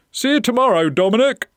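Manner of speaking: high, loud farewell call; called goodbye. Acted. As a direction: call after departing visitors. Owner calls as they leave.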